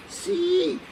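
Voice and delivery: high, small squeaky yes; thin yes. Retold or imitated. Nervous doctor answering the shouting man, thin voice. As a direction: let the yes come out thin and timid. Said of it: "el sí me salió un poco fino".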